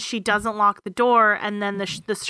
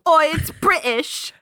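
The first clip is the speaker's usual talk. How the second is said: higher, drawn-out put-on British accent; mock British accent; 6 semitones higher, slower than usual. Co-host echoing that it's British, in a mock British accent. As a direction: ham up a slow British accent.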